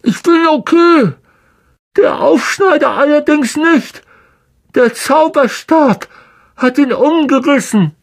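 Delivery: put-on high boyish voice, a little shaken; Ron's voice. A narrator's reading. Ron telling Harry about Lockhart: shaken boy's voice. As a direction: report back, relieved but rattled.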